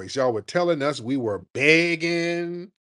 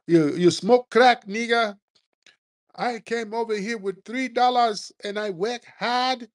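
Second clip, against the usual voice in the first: hammed-up scolding immigrant; mock immigrant scold; 8 semitones higher than usual. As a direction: sneer it like a scolding immigrant caller.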